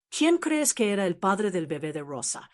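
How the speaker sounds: plain reading voice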